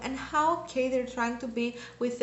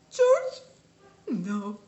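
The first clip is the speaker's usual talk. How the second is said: grief; 5 semitones higher, slower than usual. Retold or imitated. Reenacting grief at a death.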